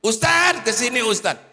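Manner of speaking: high call after someone down the street. Retold or imitated. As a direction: shout to stop him from a distance. Grandmother calling after the ustad.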